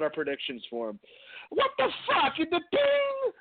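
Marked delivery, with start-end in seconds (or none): 1.5-3.3 put-on much higher Italian-accented outburst; high comic Italian accent, shocked; Pat Patterson bit. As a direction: blurt it in the hammy Italian voice. Reprising the Patterson voice.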